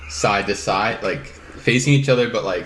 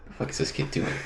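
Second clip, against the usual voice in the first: under-breath mutter; 8 dB quieter, faster than usual. Retold or imitated. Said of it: "I'm like".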